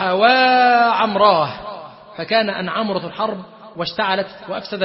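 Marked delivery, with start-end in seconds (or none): 0.0-1.5 long, slow, louder cry of a name; a drawn-out wailing call for a dead brother. A narrator's reading. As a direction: stretch the call out like a mourning cry. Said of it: "وصاح".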